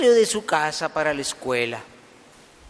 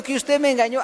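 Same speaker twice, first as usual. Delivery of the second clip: high-pitched acted complaint; indignant accusation; 8 semitones higher, faster than usual. Tricked boy confronts the lizard, indignant accusation. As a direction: keep the cheated child's voice up high.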